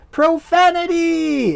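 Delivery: slow, high, stretched-out Profanity!; Princess Bride imitation. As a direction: drag Profanity! out like a film villain. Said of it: "Princess Bride style".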